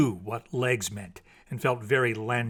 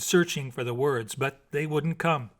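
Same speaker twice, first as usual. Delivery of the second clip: ordinary delivery, nothing marked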